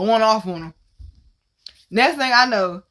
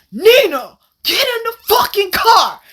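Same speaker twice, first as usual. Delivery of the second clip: higher, louder barked order from the car; mock commanding shout; 8 semitones higher, 4 dB louder than usual. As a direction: shout it like a friend pulling up to rescue. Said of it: "All I hear is".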